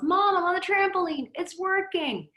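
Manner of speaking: put-on high, gleeful kid calling out from the trampoline; mock a thrilled boy yelling to mom; excited child's yell. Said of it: "he yells".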